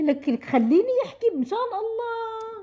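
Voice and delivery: high, near-crying plea to be heard; begging her to finally let her speak; exasperated pleading. Acted. (توسل متضايق على حافة البكاء)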